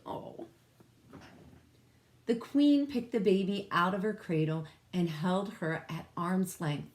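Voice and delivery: ordinary delivery, nothing marked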